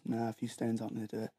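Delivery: normal talking voice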